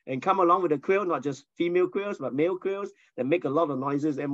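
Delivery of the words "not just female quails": normal talking voice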